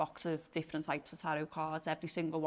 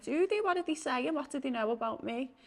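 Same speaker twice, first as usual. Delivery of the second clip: high, eager questions that settle down by the end; eager curiosity; 7 semitones higher than usual. Retold or imitated. Answering the friend on DMT, eager and curious. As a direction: sound keen to know what the clowns said. Eager reply about the clowns.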